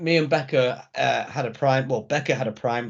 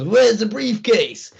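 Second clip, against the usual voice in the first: higher, louder, slow bewildered question; mock R-Truth; 7 semitones higher, 4 dB louder, much slower than usual. Retelling R-Truth on the ladder, slow and confused. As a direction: drag it out like a lost R-Truth. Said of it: "and he goes".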